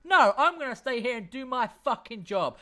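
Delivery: loud, high angry guard yelling; defensive guard shouting. Acted. Guard yelling at the party to go back. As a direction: bark it out, defensive and furious. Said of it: "and this one goes".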